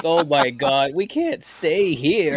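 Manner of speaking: slow, high mock panic; mock alarm. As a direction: act spooked by the number. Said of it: "and I was like".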